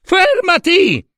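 high, long-drawn shriek; piercing panicked cry; desperate scream. A narrator's reading. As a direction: scream after him to stop, stretching the word. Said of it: "urlò Era".

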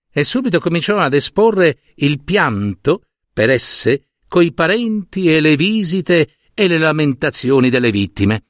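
ordinary delivery

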